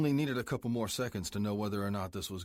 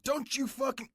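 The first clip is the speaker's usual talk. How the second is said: high shouted order, cut off. Acted. President shouts at biker just before the gunshot. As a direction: yell it and get cut off by the shot. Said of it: "Blackjack roared".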